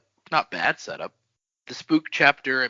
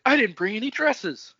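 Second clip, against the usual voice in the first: high, dismayed cry; dismayed girl; 11 semitones higher than usual. Retold or imitated. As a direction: pitch up, sudden dismay. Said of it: "She's like".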